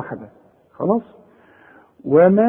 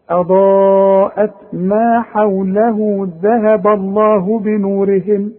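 slow tajweed chant with long held vowels; Quran recitation; 3 semitones higher, much slower than usual. Shown as a demonstration. Teacher models the verse in slow Quran recitation. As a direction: recite the verse slowly on steady notes.

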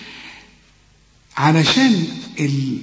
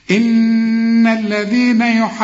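Quran recitation; 6 semitones higher, much slower than usual. Shown as a demonstration. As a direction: chant the verses with long held vowels. Full recitation of the verses.

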